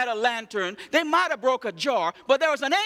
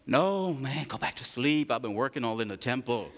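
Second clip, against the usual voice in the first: sleepy Eli; 11 semitones lower, 5 dB quieter than usual. Acted. Sleepy Eli sending Samuel back.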